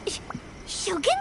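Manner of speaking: whispered, wary guess; hushed question. Acted. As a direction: whisper it nervously. Paimon unsure who appears.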